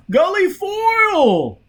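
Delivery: very high, slow drawn-out howl. A narrator's reading. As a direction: stretch the name into a howl. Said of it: "Bunny howled".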